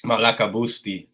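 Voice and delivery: said in a put-on Greek accent; Greek phrase. Shown as a demonstration. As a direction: voice it like a Greek speaker. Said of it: "little bit of Greek out there for y'all".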